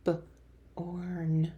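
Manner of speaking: B-orn whispered, onset split from the rest; whispered segmented B-orn; whispered sounding out. Shown as a demonstration. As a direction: breathe B then orn apart. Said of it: "Way to blend those sounds".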